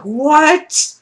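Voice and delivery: high, loud what-are-you-doing; high-pitched disbelief at the creepy man; incredulous. Retold or imitated. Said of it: "it's like".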